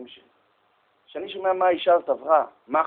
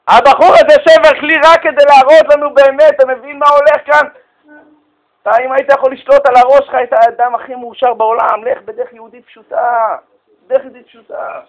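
loud, raised pitch sermon; full force preaching; 10 semitones higher, 17 dB louder than usual. Acted. Preacher drives the moral home to listeners, loudly. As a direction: drive the lesson home to the crowd.

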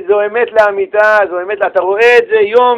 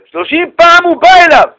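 loud, high, exasperated; exasperated exclaim; 9 semitones higher, 6 dB louder than usual. Real, not acted. Teacher telling the class about thirty visits, loud and exasperated. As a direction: throw up your hands at the nerve.

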